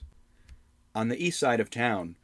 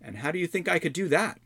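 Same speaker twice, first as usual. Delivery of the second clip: put-on curious young hero; 8 semitones higher than usual. Albrecht asks.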